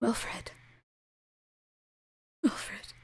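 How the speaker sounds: soft, hushed calling of a name; quiet voice. A narrator's reading. A quiet voice calling Wilfred from the grave, hushed. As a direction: call his name from far away, barely audible. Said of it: "The voice is quiet".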